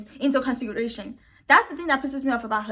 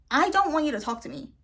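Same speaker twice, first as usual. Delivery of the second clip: normal talking voice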